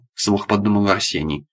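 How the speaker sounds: usual speaking voice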